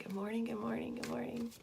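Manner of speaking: fully whispered good morning. Real, not acted. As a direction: breathe it out as a whisper. Same greeting fading into whisper.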